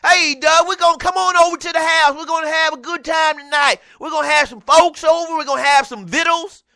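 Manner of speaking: high, loud hillbilly invitation; country twang. Retold or imitated. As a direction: imitate a country friend hollering you over. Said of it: "these jokers sounded like a country western album".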